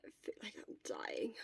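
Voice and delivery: breathy sick whisper, barely there; fading words of someone feverish; weak whisper. Acted. Sick Shota whispering weakly.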